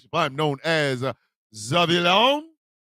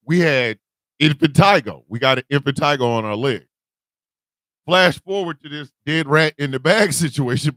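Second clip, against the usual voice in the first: ordinary delivery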